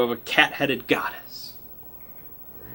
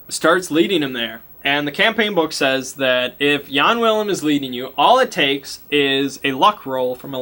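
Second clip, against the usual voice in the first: ordinary talk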